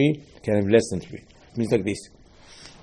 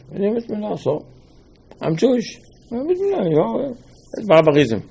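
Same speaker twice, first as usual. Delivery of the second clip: much higher, mocking voice; scoffing objector; 7 semitones higher than usual. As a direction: sneer at the custom in a high put-on voice.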